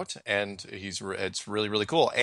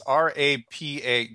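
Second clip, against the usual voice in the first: letters spelled out one by one, slowly; 4 semitones higher, slower than usual. Shown as a demonstration. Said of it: "but I can't pronounce it".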